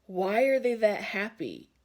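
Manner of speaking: loud, indignant imitation; mock mad. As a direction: demand it like someone put out. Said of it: "they get mad and they're like".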